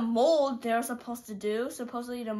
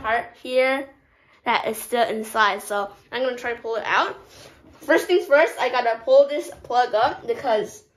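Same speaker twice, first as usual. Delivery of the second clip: talking with lip pulled down; finger pulling lip down; 8 dB louder than usual. Real, not acted. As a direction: mouth held open by finger. Seen 2: From 1.1s a finger pulls the lower lip down, baring teeth.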